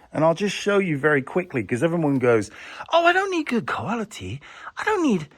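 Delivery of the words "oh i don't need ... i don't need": high-pitched mimicry of customers; mock-dismissive customer. Vlogger imitating customers who settle for less, mockingly high.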